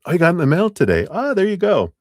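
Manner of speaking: high, loud, fast burst of delight; suddenly thrilled and quick; excited. Real, not acted. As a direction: blurt it out thrilled at the news. Excited, book arrived in the mail.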